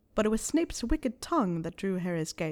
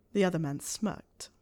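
plain reading voice

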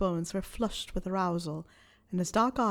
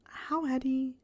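higher, whispery startled thought; airy, raised inner question; Harry; 5 semitones higher, slower than usual. A narrator's reading. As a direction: think it aloud, taken aback.

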